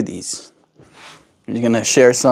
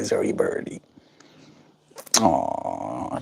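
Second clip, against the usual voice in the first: ordinary talk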